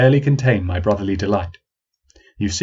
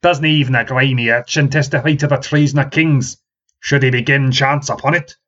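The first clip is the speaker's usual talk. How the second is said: put-on forceful, louder challenge in a country accent; old man challenging the young woman, rustic accent; pointed challenge; 4 semitones higher, 4 dB louder than usual. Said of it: "He thrust at her".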